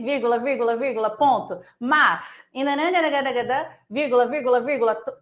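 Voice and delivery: rapid nonsense babble broken by stressed connectives; mock gibberish reading. Teacher parodying an overfilled paragraph, babbled mock reading. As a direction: rattle through it, punching each linking word. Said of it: "eu sei que tá assim".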